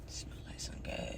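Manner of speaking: breathy whisper, soft and close; hushed whisper. Real, not acted. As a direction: murmur it like a secret. Describing the smell quietly.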